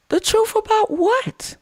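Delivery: high, slow, mocking girl voice, drawn out; lightly mocking high girl voice; mock shocked girl. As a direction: play her as a scandalized girl. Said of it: "She goes".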